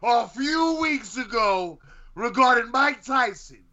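high-pitched, drawn-out mock outrage; indignant accusation. Accusing co-host of lying about boxing, indignant and high-pitched. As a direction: pitch it way up and stretch out the charge.

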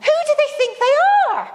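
mock furious tirade climbing high and fast at the end; ranting outrage. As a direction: replay the car tirade, climbing in pitch. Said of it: "I let Steve have it, all guns blazing".

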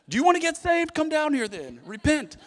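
high, loud, hammed-up preacher's appeal; mock altar call. Preacher in his shower calling wife to repent, mock altar call. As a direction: call sinners forward in a comic pulpit shout. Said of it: "I'm right at the altar call".

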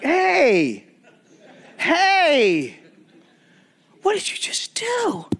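high, drawn-out mock-shocked "hey"; mock astonishment, stretched out and falling; stunned protest. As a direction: react in high-pitched disbelief. Stunned reaction to principal quoting scripture.

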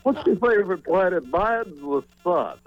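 joking imitation of a famous announcer; Harry Carey impression. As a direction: drag it out like a muddled old broadcaster.

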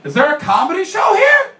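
loud, high-pitched shouted question; calling out. Retold or imitated. Calling out on entering the bar.

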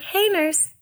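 high, sweet little call for attention; mock-casual high-voiced summons; polite call. Acted polite call to the nurse.

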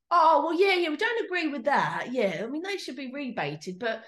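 high, loud Cockney-tinged New Yorker impression; attempted New York accent slipping into Cockney; failed New York accent. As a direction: retell him in an accidental Cockney accent. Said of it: "New York accent has now turned into Cockney".

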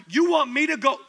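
incredulous question. Acted. Ananias disbelieving God's order.

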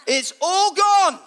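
high, loud, stretched cry; full-force preaching proclamation; exultant shout. Real, not acted. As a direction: shout it slowly as triumph.

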